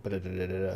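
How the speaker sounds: quick, soft stutter noise; imitated stutter. Developer showing how audio playback repeats, imitated stutter. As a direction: rattle off a repeating glitch softly. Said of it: "like a stutter".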